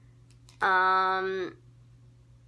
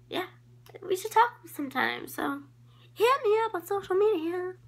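squeaky gushing invite; 11 semitones higher than usual. Acted. High gushing end of a shoutout.

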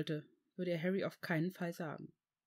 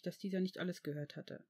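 plain reading voice

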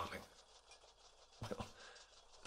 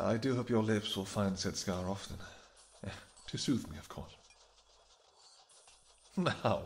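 ordinary talk, nothing marked

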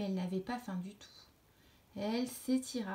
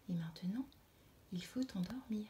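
a gentle bedtime whisper, softer; 6 dB quieter than usual. Acted. Butterfly whispers to the sleepy caterpillar to go to sleep. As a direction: hush her to sleep in a whisper.

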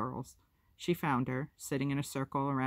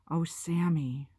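slow, higher, worried dismay; 4 semitones higher, 4 dB louder, slower than usual. A narrator's reading. Sister seeing her little brother's cut forehead, worried. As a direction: let the worry show as you draw out the words. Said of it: "couldn't keep the worry out".

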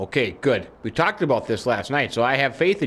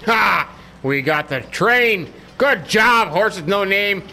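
high, loud victory cheer; triumphant; 8 semitones higher, 4 dB louder, much slower than usual. Pretend. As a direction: crow to your horse about the win. Celebrates catching the train.

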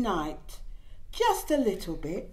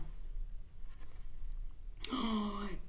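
gasp; 11 dB quieter than usual. Acted. Reading a baby book aloud.

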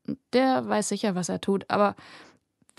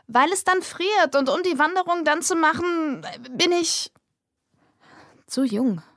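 nervös sprudelnd; 7 semitones higher than usual. Acted. Nervöse Rede vor dem Theater.

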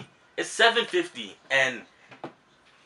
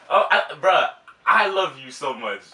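normal talking voice

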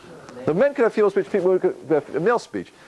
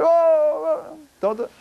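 mock shouting date; 12 semitones higher, much slower than usual. Mock date across the table.

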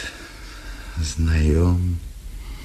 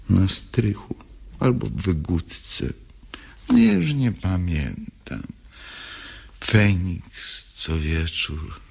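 ordinary delivery

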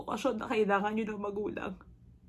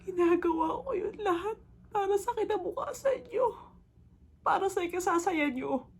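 high, tearful insistence; crying plea; 6 semitones higher than usual. Acted. Mother insists all she does is for her children's future, crying plea. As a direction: cry it out, pitched well above normal.

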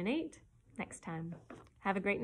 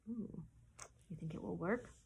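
soft aside; 2 semitones lower, 9 dB quieter than usual. Real, not acted. Aside to listeners.

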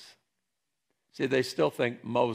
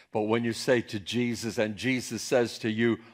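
ordinary talk, nothing marked

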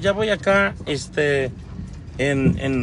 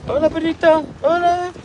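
talking to a dog; 10 semitones higher than usual. Real, not acted. Greeting a dog on the road.

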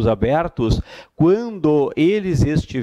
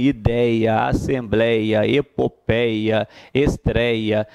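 slow listing; 4 semitones lower, much slower than usual. Shown as a demonstration. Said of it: "teacher listing examples slowly".

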